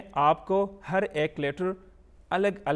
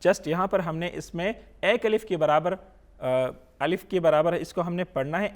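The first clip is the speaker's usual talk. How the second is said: ordinary delivery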